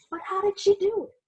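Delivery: bright mock-suspense question to the kids; excited question. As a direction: ask it like a thrilling mystery. Dramatic question in read-aloud.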